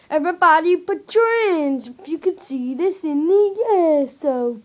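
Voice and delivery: loud excited greeting. Real, not acted. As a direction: hype up the audience. Loud excited opening to camera.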